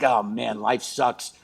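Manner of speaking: low, soft mopey sigh; hammed-up misery; mock gloom. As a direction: sink into it, low and quiet. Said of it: "I'm just sort of like".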